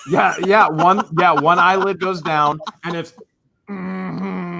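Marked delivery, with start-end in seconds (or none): 3.7-4.6 annoyed mm-hmm. Retold or imitated. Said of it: "she does when she's mad at me".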